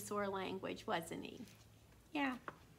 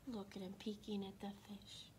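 hushed whisper; 2 semitones lower, 12 dB quieter than usual. Acted. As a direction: whisper as if not to disturb. Whispers about the peeking dinosaur.

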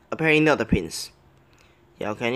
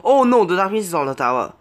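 loud, high cry of alarm; real gamer's alarm, pitched up; 6 semitones higher, 6 dB louder than usual. Real, not acted. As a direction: blurt it out as danger appears. Sudden threat to the tower.

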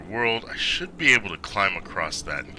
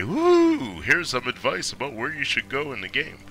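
helpful game character; 6 semitones higher, 4 dB louder than usual. Retold or imitated. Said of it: "I kinda expected him to say".

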